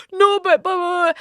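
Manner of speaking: high, babbling mock distress; mock whining protest. Woman defending herself to her friend, high mock whining. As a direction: whine the blahs as hammed-up protest. Mimics own tearful protest.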